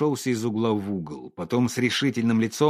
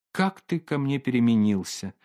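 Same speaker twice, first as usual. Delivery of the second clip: usual speaking voice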